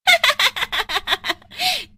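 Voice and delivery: high witch cackle; wicked triumphant laugh; evil cackle. Acted. Goat witch revealing herself, high evil cackle.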